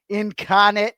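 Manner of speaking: light Boston accent on Incarnate Word, r dropped; a playful Boston-accent demo of "Incarnate Word". Shown as a demonstration. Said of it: "said it in a Boston accent".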